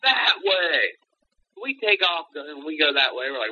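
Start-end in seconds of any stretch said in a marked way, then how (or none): 0.1-1.0 retold call. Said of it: "He's like".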